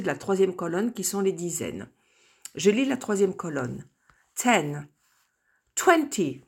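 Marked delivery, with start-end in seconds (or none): 4.3-6.4 twenty with the first syllable stressed. Shown as a demonstration. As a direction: hit the front of twenty. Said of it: "donc accent tonique sur le pr-, la première syllabe".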